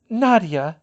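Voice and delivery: high, loud, decided announcement; firm. A narrator's reading. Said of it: "Fleur said firmly".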